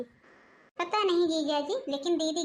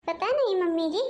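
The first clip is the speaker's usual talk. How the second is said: normal talking voice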